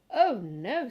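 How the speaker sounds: a loud, firm "Oh, no". A narrator's reading. Mother stops her son from picking the flower, firm. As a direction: stop him firmly but kindly.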